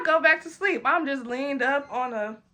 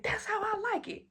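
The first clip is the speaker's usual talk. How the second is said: much higher, softer tipsy coo; happy drunk squeal; 10 semitones higher, 7 dB quieter than usual. Retold or imitated. Finally getting ketchup, high tipsy delighted coo. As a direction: coo it like a pleased tipsy child.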